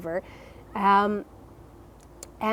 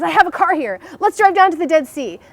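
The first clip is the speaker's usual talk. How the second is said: imitating a hyped-up friend, higher and louder; hyper friend; 8 semitones higher, 7 dB louder than usual. Hyper friend urging a drive to the Dead Sea, higher and louder. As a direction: mimic his bouncing-off-the-walls energy. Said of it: "He is hyper".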